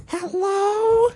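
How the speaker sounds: squeaky high-pitched woman's hello; mock high woman's voice on the phone; high lady's hello. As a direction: answer the phone like a startled old lady.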